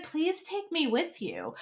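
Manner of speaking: soft, slow earnest plea; sincere plea. Retold or imitated. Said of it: "this is the most sincere request ever".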